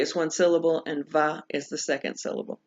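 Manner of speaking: ordinary talk, nothing marked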